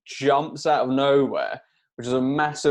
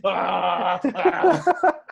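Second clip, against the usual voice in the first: imitated growl; 5 semitones higher, 3 dB louder than usual.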